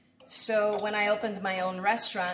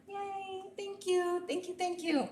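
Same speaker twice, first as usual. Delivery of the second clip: cute squeal of thanks; 10 semitones higher, 4 dB quieter than usual. Real, not acted. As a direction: squeal a happy welcome back. Welcoming a returning viewer.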